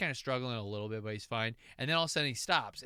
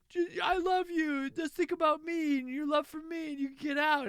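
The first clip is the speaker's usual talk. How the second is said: pleading Atreyu; 3 dB louder than usual. Acted. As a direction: plead with all your heart.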